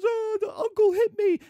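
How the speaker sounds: high-pitched mock wail; tattling child. Nephew complaining to his father, high tattling child voice. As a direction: whine it high like a little kid telling on someone. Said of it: "And he goes".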